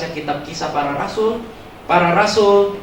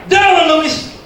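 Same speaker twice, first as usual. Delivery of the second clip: high, loud mock exorcist shout; shouted exorcism; 13 semitones higher, 7 dB louder than usual. Friend's third try at casting out the spirit, shouting. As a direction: escalate to an over-the-top casting-out yell. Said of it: "Pelankan suaramu".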